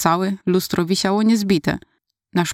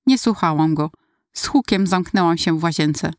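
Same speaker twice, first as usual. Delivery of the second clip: ordinary delivery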